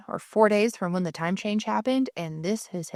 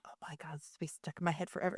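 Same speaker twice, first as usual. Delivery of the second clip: unvoiced whisper, fast and much softer; 4 semitones lower, 14 dB quieter, faster than usual. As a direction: hush it like a private thought. Retold inner thought, whispered.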